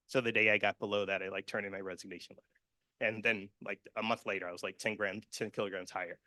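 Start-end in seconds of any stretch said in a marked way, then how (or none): none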